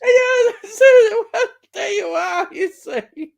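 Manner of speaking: high, laughing reply; real laughter through the words. Real, not acted.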